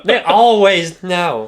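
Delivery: parrot voice. Retold or imitated. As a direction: squawk it through a laugh. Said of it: "sounded like a parrot".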